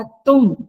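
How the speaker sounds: तुम punched out loud on its own; stress on तुम. Shown as a demonstration. Teacher showing whom the order is aimed at, stress on तुम. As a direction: hit तुम with firm, loud weight. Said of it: "तो बल लगाया".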